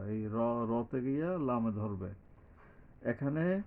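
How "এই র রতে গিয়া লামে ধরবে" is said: ordinary delivery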